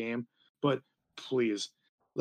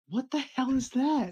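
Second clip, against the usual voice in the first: high-pitched, drawn-out incredulity; mock bafflement; 12 semitones higher, slower than usual. Local reacting to an unknown stadium name, mock bafflement.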